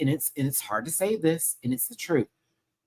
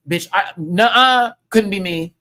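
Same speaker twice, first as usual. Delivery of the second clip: loud nuh-uh; 5 semitones higher, 8 dB louder than usual. Real, not acted. Refusing to take insult.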